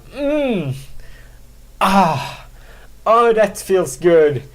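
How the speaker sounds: slow, sighing mock relish; hammed-up sighs of pleasure. Splashing himself with water, mock blissful sighs. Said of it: "be like".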